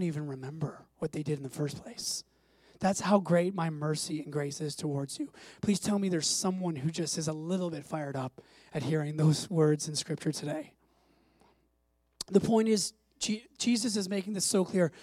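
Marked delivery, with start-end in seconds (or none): none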